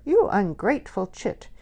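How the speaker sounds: indignant mother's scolding cry; angry cry. A narrator's reading. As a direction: let her have it roundly. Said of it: "she cried roundly".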